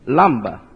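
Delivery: "lumber" falling low on a weak last syllable; correct pronunciation. Shown as a demonstration. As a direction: let the end of "lumber" drop to an unstressed "buh". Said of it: "correct pronunciation of this word is lumber".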